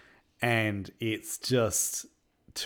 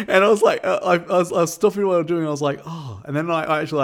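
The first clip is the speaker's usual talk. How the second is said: excited; 9 semitones higher, 10 dB louder, faster than usual. Real, not acted. Excitedly retelling a movie moment.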